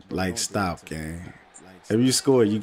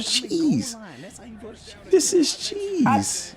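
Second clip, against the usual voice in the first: drawn-out mocking; 11 semitones higher, much slower than usual. Drawn-out gloating call of cheese.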